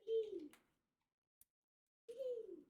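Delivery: high delighted squeals of triumph; gleeful squeals. Real, not acted. Wrapping goes well, gleeful high squeals. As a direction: squeal "eee" then a happy "woo". Excited over the folding.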